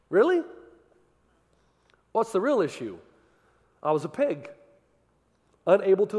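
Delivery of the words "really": incredulous. Acted. Incredulous reply.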